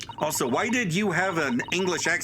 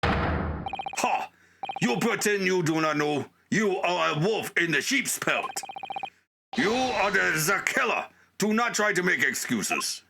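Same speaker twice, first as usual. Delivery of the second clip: put-on higher-pitched accusation in an amateur Russian accent; indignant Russian-accented interrogator; 5 semitones higher than usual. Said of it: "I don't think I can do a Russian accent".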